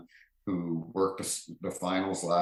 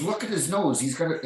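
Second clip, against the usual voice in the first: much higher and louder, gleeful; gleeful jab; 10 semitones higher, 4 dB louder than usual. Retold or imitated. Avery showing the ref the nose, high and gleeful. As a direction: point it out with delight.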